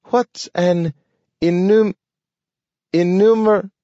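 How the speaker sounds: high dog voice tripping over the word; can't get the word out; stumbling dog. A narrator's reading.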